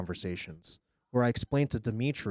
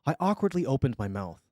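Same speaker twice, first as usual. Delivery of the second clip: ordinary delivery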